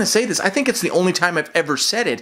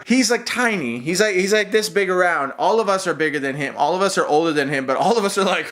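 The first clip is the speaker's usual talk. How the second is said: normal talking voice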